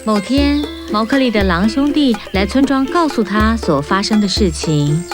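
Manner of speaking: ordinary delivery, nothing marked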